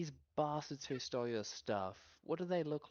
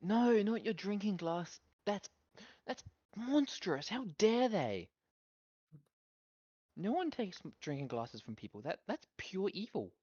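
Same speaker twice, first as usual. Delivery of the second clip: mock outrage; 6 semitones higher, 4 dB louder than usual. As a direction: gasp at it like a tragedy. Mock outrage at stolen glass.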